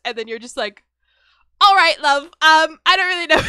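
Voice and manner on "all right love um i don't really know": loud, higher, hammed-up British accent; shitty British accent. Spouse asks for divorce, mock British accent reply. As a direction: fake British accent to dodge the moment. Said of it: "break out into a shitty accent".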